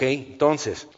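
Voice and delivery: usual speaking voice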